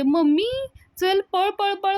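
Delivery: hurry, scared, run-run-run; girl urging her friend to flee the elders, panicked and high. Acted. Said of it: "पायल आणि नमी घाबरून उठतात".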